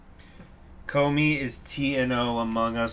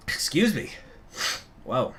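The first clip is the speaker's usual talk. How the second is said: ordinary talk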